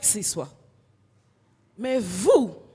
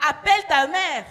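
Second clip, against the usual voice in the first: loud, higher angry order; angry shout; 6 semitones higher, 6 dB louder than usual. Acted. Angry pillow yelling at the girl, loud. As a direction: bark it at her.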